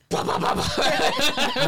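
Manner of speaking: high, loud, drawn-out laughing taunt; sing-song it slowly, way up high, cracking up; mock taunting chant. Retelling how he pelted the rude guy's car with ice, laughing taunt. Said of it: "And I was like".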